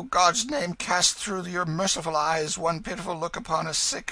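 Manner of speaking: high, wailing beggar's plea; miserable crying out. Acted. Hugo coaching the King to beg, mock wailing. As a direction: plead piteously in a put-on wail. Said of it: "cry out as all the devils of misery were in your belly".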